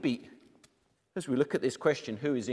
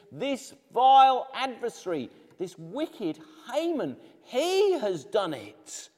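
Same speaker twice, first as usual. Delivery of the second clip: accusing queen; 7 semitones higher, 5 dB louder, slower than usual. Acted. Esther accusing Haman.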